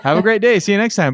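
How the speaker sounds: lightly acted, upbeat customer-service farewell; breezy send-off. Acts out cheerful send-off to customer.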